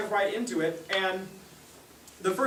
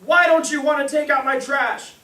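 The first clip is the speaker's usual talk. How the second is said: loud, high mock outburst; hammed-up furious parental yell; parent blowing up; 8 semitones higher, 10 dB louder, slower than usual. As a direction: explode like an outraged parent. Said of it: "kind of blow up and be like".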